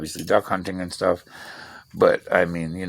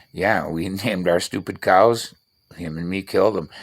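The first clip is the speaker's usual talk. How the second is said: normal talking voice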